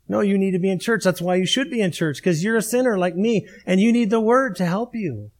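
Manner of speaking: ordinary delivery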